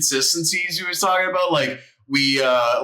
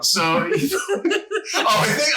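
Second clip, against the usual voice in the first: high, slow laughing; laughing as he talks; 10 semitones higher, much slower than usual. Real, not acted. Laughing while telling story.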